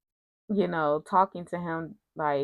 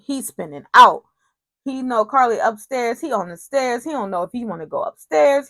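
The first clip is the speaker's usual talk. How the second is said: high, loud dramatic recap; excited; 6 semitones higher, 5 dB louder than usual. Real, not acted. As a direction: hype up the drama. Dramatic retelling.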